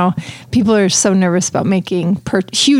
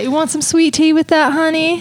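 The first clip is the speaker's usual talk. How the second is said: Southern hostess; 7 semitones higher than usual. Pretend. Host teasing cohost in a mock Southern hostess voice. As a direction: offer sweet tea like a Southern belle.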